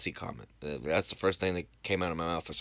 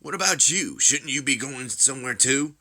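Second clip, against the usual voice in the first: high, pointed comeback; needling, raised-voice retort; challenging; 6 semitones higher, 5 dB louder, slower than usual. Acted. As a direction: throw the question back, pitched up. Acted confrontation between rivals.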